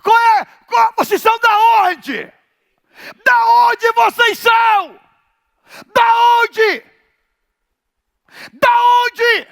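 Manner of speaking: high, loud shouted demon questions; demon shouting. Acted. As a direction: bark the questions like a possessed man. Preacher voicing demon confrontation. (grito do endemoniado)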